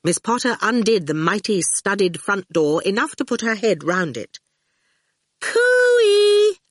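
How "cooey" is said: high, loud singsong cooey; calling out. A narrator's reading. Miss Potter at her friend's front door, calling. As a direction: trill it out high to carry indoors. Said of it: "she called".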